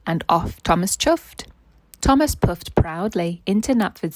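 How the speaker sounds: put-on bright, lively read-aloud for a child; story narration. A narrator's reading. Parent reading a Thomas annual story aloud to a child, animated narration. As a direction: read it like a bedtime picture book.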